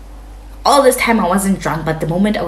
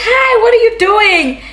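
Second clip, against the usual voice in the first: high, louder chirpy hello; bright friend's greeting; 6 dB louder than usual. Retold or imitated. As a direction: greet her like a surprised friend. Best friend walks in.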